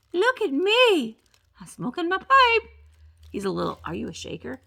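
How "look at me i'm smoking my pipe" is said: lightly voiced figurine. Voicing pipe-smoking figurine.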